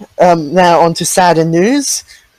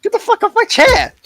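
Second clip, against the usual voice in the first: much higher, outraged yell; indignant yell. Real, not acted. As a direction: shout him off your chair. Outraged at chair being taken.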